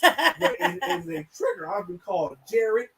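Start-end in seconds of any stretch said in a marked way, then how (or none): 0.0-1.7 laughing insistence. Real, not acted.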